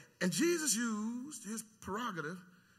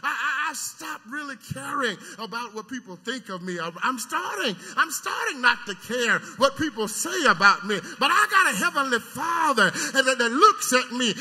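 usual speaking voice

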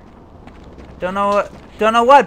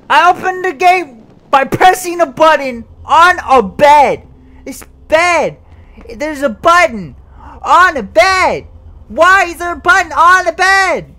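loud, high disbelieving outburst; can't believe there's a button there; baffled excitement; 7 semitones higher, 8 dB louder than usual. Real, not acted. Gamer baffled by a button on a bed, loud high disbelief.

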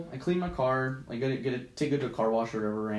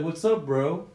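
bro greeting; 3 semitones higher, slower than usual. Retold or imitated. Neighbor walking out to greet her, lightly voiced bro greeting. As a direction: toss out a casual bro hello.

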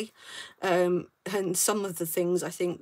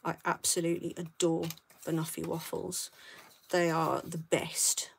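whisper; 3 semitones lower, 6 dB quieter than usual. Real, not acted. Confiding love of banoffee waffles.

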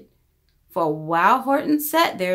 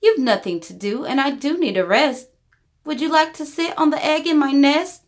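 lightly raised, coaxing bird voice; a lazy bird sweetly asking a favour; chatty little bird asking nicely; Maisie the bird; 7 semitones higher, 3 dB louder than usual. A narrator's reading. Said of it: "answered Maisie".

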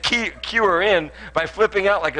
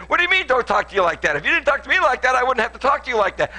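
higher and louder, heated comeback; mock argument; 10 semitones higher, 6 dB louder than usual. Husband snapping back at his wife, high loud mock anger. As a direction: throw her words back at her, heated. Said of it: "And I'm gonna say".

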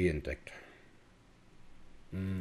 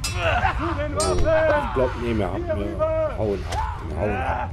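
panicked shout; 9 dB louder, slower than usual. Real, not acted. Panicked retreat from fight.

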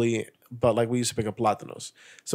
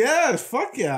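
excited; 11 semitones higher, much slower than usual. Real, not acted. Enthusiastic agreement.

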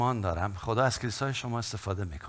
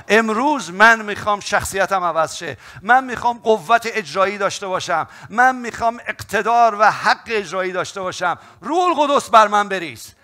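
high, loud, impassioned altar call; fiery preaching; 9 semitones higher, 11 dB louder than usual. Acted. Preacher urging the church to pray, fiery and loud. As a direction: preach it at full force to the congregation.

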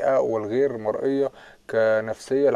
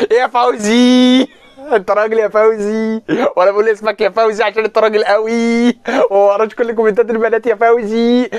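teasing praise; 9 semitones higher, 7 dB louder than usual. Real, not acted. Teasing viewer Fawzy for reading girls' comments, high and loud. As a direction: hype him up while poking fun.

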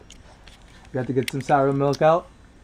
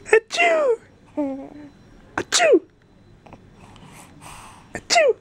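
fake high sneeze; slower than usual. Fake sneeze to amuse baby.

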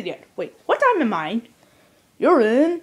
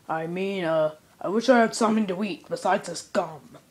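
usual speaking voice

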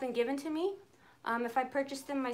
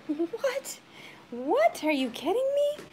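high, louder cries of disbelief; retold disbelief; 9 semitones higher, 4 dB louder, slower than usual. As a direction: replay the shock of the gift. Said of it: "I was like".

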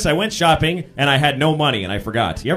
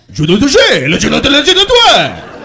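loud mock French gibberish, higher; 6 semitones higher, 9 dB louder than usual. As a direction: bellow fake French at a stranger. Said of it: "He's like".

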